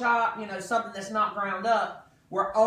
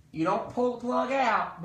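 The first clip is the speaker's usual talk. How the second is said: ordinary talk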